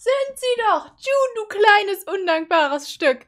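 high, loud indignant scolding; outraged yelling. Real, not acted. Reactor berating June as ungrateful, high loud outrage. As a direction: yell it, then drag out the insult slowly.

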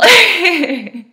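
a loud, high, breaking laugh; tearful laugh. Real, not acted. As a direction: laugh as tears come. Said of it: "before I get too emotional".

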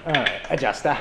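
Cockney-ish adjuster, dropped r; light London twang; cockney accent. Retold or imitated. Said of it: "You guys sound like you're doing a Cockney impression".

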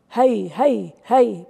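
loud swooping drawn-out heys; spooky shout. Acted. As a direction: call it out forcefully like a forest spook. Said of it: "beigt's gleich noch einmal". (lauter, langgezogener Spukruf)

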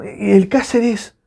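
exasperated high-pitched rant; bronca exasperada. Real, not acted. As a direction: demand to know where the player is. Rants about missing defender. (bronca indignada, en tono agudo)

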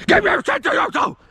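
very high hammed-up yell; strained mock scream. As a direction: screech the warning in mock fury. Same warning, exaggerated repeat.

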